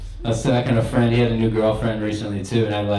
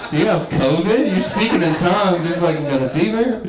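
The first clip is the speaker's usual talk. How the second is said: put-on girlfriend's shock; 7 semitones higher, 3 dB louder than usual. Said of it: "she's like".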